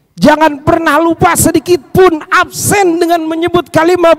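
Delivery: high, insistent preaching with stretched key words; fervent exhortation. Real, not acted. Preacher urges congregation to always name Allah, fervent exhortation. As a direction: drive each phrase home from the pulpit.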